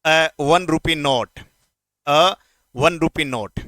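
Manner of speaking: A one rupee note said slowly, word by word; slow teaching demo. Shown as a demonstration. Teacher slowly models the phrase for his students. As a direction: dictate it slowly so the class can copy. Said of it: "note लगा दो".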